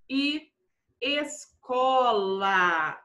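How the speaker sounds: "escola" very slow, syllable by syllable; slow dictation. Shown as a demonstration. Teacher gives pupils an example word, slowly dictated.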